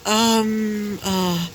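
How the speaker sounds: drawn-out hesitant um; stall, slightly taken aback; awkward surprise. A narrator's reading. Girl caught off guard by the group, awkward hesitation. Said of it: "a little bit surprised, but also quite awkward".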